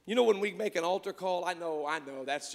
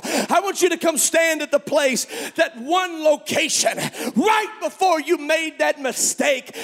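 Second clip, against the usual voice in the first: preaching at full force; 8 semitones higher, 12 dB louder than usual. Acted. Preacher voicing God's invitation to the altar, shouting. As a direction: plead at the top of the voice.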